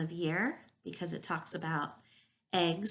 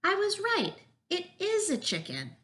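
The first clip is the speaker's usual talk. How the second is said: high, emphatic exclamation; pitched way up, vindicated; exclaiming; 14 semitones higher, 5 dB louder than usual. A narrator's reading. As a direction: insist delightedly that you were right. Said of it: "exclaimed Marilyn".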